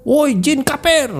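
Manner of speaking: high, drawn-out yell at a jinn; defiant mock shout; teriak, acted shout. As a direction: call it out high and stretched. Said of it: "Dia teriak lagi".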